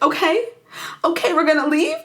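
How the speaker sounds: high, rising, hopeful question; happy hope. Retold or imitated. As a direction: imitate someone daring to get excited. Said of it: "she's so happy".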